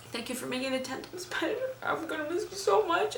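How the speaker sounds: soft, high, tearful farewell; tearful goodbye. Real, not acted. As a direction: say goodbye through tears. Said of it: "Enough of my crying".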